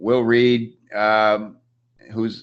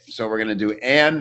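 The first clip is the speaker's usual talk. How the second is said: usual speaking voice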